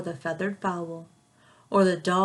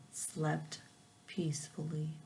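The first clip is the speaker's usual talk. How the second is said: soft fade; 4 semitones lower, 12 dB quieter than usual. Acted. Lullaby-like story ending.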